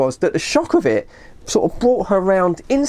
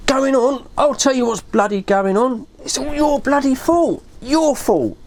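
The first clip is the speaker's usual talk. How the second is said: mildly indignant, higher-pitched retort; retold blaming outburst, raised in pitch; worked up; 5 semitones higher than usual. Dave blaming his friend for the disaster, worked up. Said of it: "He was so worked up".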